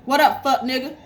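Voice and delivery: loud, rising street greeting; mock call-out. Grandma imitating grandson calling to the neighbor, loud. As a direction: holler it like the little grandson.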